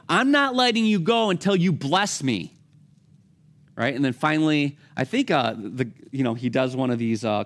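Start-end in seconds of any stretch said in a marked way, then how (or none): 0.1-2.6 determined Jacob. Retold or imitated. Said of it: "And Jacob's like".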